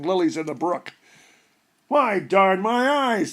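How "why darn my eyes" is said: put-on slow, amused old man's oath; grinning old man. A narrator's reading. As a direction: drag it out with a grin. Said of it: "says the old man with a grin".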